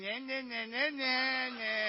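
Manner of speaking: soft mocking nyah-nyah; small, jeering devil voice; taunting devil. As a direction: needle the victim with a teasing chant. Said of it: "Setan bilang".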